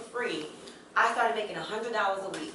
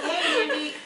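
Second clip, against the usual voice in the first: laughing; 12 semitones higher, 3 dB louder than usual. Retold or imitated. Retold call, laughing.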